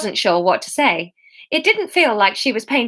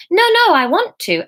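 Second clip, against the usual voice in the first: insisting; 9 semitones higher, 5 dB louder than usual. Acted. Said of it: "Emma insisted".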